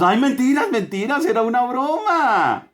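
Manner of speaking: high mocking "mentiras"; mocking excuse. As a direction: toss it off like it was nothing.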